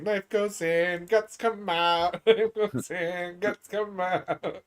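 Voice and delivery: slow, sing-song chant; Bart Simpson quote. Retold or imitated. Quoting the Simpsons knife line to a friend, slow mock-menacing chant. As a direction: chant it slowly like a creepy kid. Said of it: "that Bart Simpson reference".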